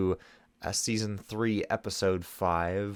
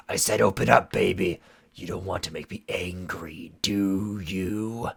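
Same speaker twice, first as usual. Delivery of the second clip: menacing threat; 4 dB louder than usual. Acted. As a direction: threaten with false sweetness. Shadow Weaver threatening.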